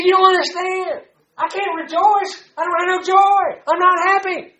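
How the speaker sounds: high, whiny complaining voice; whiny protest. Retold or imitated. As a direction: whine like a stubborn child. Arguing with God, whining.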